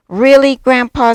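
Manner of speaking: high, very loud wondering question; amazed young cub. A narrator's reading. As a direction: ask with big childlike astonishment. Cub questions grandpa again.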